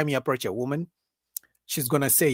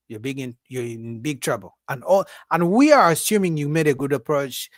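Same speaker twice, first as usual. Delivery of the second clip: ordinary talk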